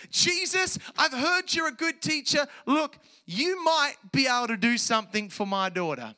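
high, loud, sing-out mock politeness; exaggerated imitation of a hesitant asker; mock tentative request. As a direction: preach a hedging plea at full volume. Mocking a hesitant request not made.

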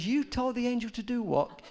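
high, disbelieving retort; incredulous question. Retold or imitated. Preacher asking the lady what she told her angel, high incredulous. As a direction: ask it as if you can't believe your ears.

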